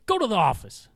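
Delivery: loud, high command; shouted order. Retold or imitated. Teacher sends the kid out of class, shouted order.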